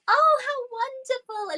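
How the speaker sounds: high, loud gasp of delight; gasp, then gush with joy. Real, not acted. Gasps at the cat news.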